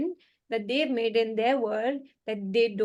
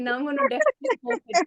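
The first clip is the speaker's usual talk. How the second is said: high, fast cheeky comeback; defiant retort; 10 semitones higher, faster than usual. Retold or imitated. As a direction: toss it back with cheeky defiance. Defiant retort to son's judgment.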